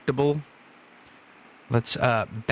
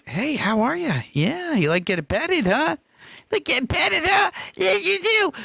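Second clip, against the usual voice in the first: squeaky dog talk; 14 semitones higher than usual. Real, not acted. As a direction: coo to the dog in a high voice. Talking sweetly to his dog.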